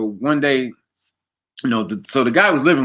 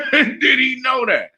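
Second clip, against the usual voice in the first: higher, drawn-out amazement with laughter; laughing disbelief; 13 semitones higher, slower than usual. Retold or imitated. Friend retelling amazement at a spot-on reading, laughing disbelief. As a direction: laugh through an astonished question, slowly.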